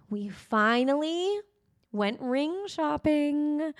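drawn out and lifted, savoring the news; slow teasing reveal. Real, not acted. Telling her podcast listeners the big ring news, slow and gleeful. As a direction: let the big news land slowly, with a grin.

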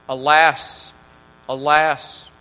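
loud, keening alas; loud lament. Acted. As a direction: wail it out like a mourning merchant. Lament in read scripture.

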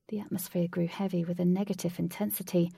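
plain reading voice

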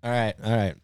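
normal talking voice

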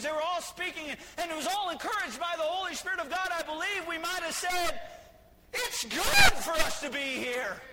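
loud, high shouted preaching; preaching at full force. Real, not acted. Preacher rousing the church to excitement, shouting. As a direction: whip the congregation up, shouting.

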